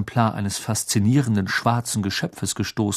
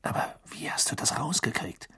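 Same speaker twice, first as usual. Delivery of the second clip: soft urgent whisper; hushed and a bit hurried; 9 dB quieter than usual. Acted. Cedric keeps whispering secret.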